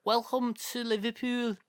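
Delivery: put-on Liverpool accent. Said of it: "they all sound like this".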